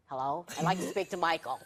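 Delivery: deep, formal phone voice; put-on low voice for a prank call; disguised prank-caller voice. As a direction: drop your voice low to fool him on the phone. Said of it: "no matter how you disguise your voice".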